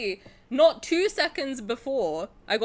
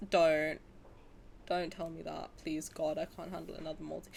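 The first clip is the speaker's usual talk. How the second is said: low, soft, weary plea; pleading dread; 4 semitones lower, 7 dB quieter than usual. Real, not acted. Gamer begging to be spared another multi, pleading dread. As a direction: drop your voice and beg under your breath. Begging no more costly banners.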